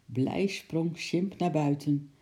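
usual speaking voice

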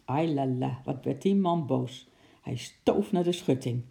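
ordinary delivery

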